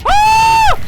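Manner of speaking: sudden high piercing scream of fright; startled scream. Real, not acted. Jump-scared gamer lets out a startled high scream.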